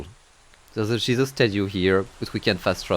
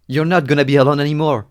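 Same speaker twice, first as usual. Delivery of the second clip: higher, louder heartfelt promise; tender reassurance; 5 semitones higher, 6 dB louder than usual. Acted. Narrator reassures the little girl, tender. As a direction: comfort her at last.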